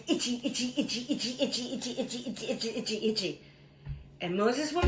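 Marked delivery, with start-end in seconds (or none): 0.1-3.4 frantic itching. Acted. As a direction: rattle it off as if scratching madly. Acts out everyone itching.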